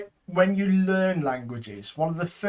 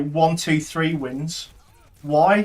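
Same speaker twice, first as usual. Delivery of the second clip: ordinary delivery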